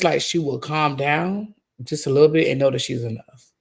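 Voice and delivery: usual speaking voice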